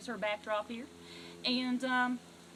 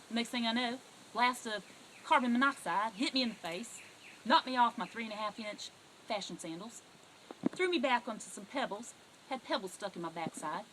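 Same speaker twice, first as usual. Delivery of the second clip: ordinary talk, nothing marked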